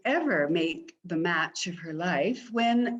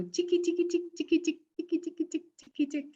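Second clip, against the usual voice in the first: high, light ticking rhythm; heartbeat patter; 8 semitones higher, much faster than usual. Acted. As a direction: tap out a racing heart. Heartbeat sound.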